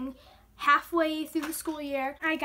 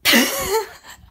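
laugh; 5 semitones higher, 9 dB louder than usual. Real, not acted. Laughs after joke about toilet.

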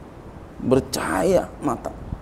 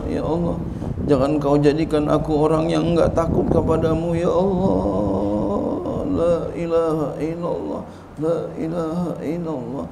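imploring prayer; 4 semitones higher than usual. Acted. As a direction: implore God, stretching the closing call long. Models a pleading prayer with dhikr.

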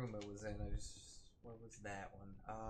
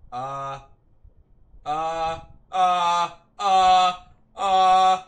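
rising terrified uh sounds, much louder and higher; panicked whimpering; 7 semitones higher, 19 dB louder, much slower than usual. Real, not acted. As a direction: panic building with each uh. Monster chase starts.

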